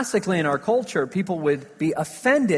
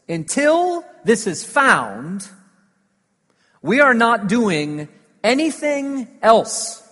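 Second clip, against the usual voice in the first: loud, slow, firm insistence; desperate insistence; 5 semitones higher, 6 dB louder, slower than usual. Retold or imitated. Husband tells wife nobody rests till the ring is found, loud and firm. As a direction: lay down the law over the lost ring.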